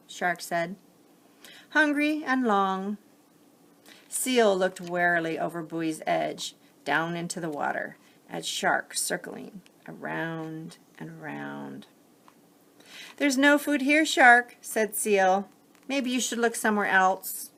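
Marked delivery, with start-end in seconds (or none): 1.7-3.0 mellow shark. Acted. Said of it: "quiet, not cold, but mellow". 13.2-17.5 wary seal. Acted. Seal warns the shark off.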